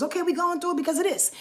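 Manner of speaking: high, fast rattled-off reassurance; mock pep talk. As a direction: rush through it like a tired script. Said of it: "give y'all some variables".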